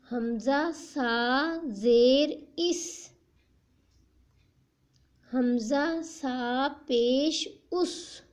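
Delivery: put-on slow, deliberate spelling-out for children; slow syllable drill. Shown as a demonstration. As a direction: spell each sound out slowly for a young learner. Teacher drills Qaida syllables slowly.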